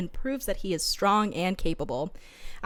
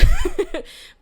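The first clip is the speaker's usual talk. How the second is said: high giggle; 8 dB louder than usual. Real, not acted. Laughing with co-host.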